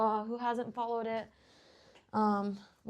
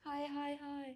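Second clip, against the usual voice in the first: cutesy soft greeting; 6 semitones higher, 8 dB quieter than usual. Real, not acted.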